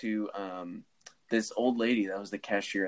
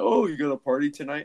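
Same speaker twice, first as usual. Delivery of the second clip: a lightly voiced chatty cashier, a bit higher and louder; imitating cashier; 5 semitones higher, 5 dB louder than usual. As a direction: do the small-talk cashier. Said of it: "He's like".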